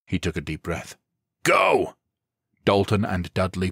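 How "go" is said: high, loud sharp "Go!"; shouted command. A narrator's reading. Team leader ordering the breach, sharp shout. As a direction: launch the team through the door.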